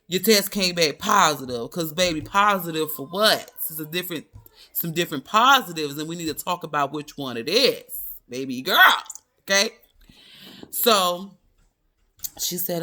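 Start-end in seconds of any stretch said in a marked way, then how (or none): 0.1-1.7 louder, mocking echo of the doctor; mocking the doctor's words, louder; mocking repeat. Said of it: "You can't be ... talking about". 1.7-9.7 sassy comeback. Retold or imitated. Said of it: "So I'm like".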